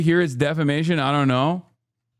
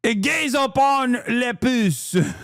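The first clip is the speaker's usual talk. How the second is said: slow, hammed-up French accent, pitched high; mock French accent; 8 semitones higher, much slower than usual. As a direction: savor each word in a silly French accent. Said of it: "in the most French way possible".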